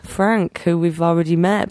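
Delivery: usual speaking voice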